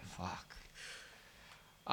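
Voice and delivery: whispered exasperated curse. Retold or imitated. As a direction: let it slip out under the breath in dread. Muttered curse at entitled customers.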